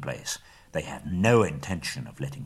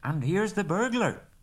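higher, pleased reveal; lightly voiced Bilbo; 11 semitones higher than usual. A narrator's reading. Bilbo surprising the dwarves, lightly voiced and pleased. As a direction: pop up with a cheeky announcement. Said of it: "said Bilbo, slipping off the ring".

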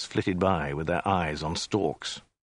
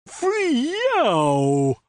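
exclamation; 4 dB louder than usual. A narrator's reading. Zaphod's exclamation.

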